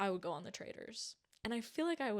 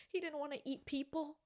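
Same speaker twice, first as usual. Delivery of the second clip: excited high pleading; 7 semitones higher than usual. Real, not acted. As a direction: plead his case, pitched up. Defending a beloved character.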